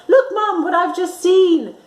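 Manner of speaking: put-on high, loud child's cry; excited girl. Girl tugging mother's sleeve at a flying saucer, high and excited. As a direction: call out excitedly to mum. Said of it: "she tugged her mother's sleeve and said".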